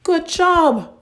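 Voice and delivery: high, loud delighted praise; cheering mom. Acted. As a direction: cheer him on like a proud mom.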